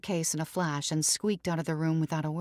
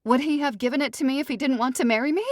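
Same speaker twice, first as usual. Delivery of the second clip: high, loud, fast pleading; jilted bride grasping at hope; desperate bride; 9 semitones higher, 5 dB louder, faster than usual. Acted. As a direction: rush the question out. Ruby pleads.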